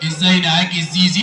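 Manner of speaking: very low puppet character voice; Aragoz puppet. Acted. Puppet telling the host his school subjects, Aragoz voice. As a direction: list it as a puppet, far below your own pitch. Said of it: "الأراجوز ده وهو بيرغي".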